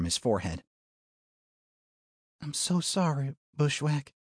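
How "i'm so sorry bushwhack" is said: soft, sorrowful hush; quiet grieving apology. A narrator's reading. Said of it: "in a voice hushed with sorrow".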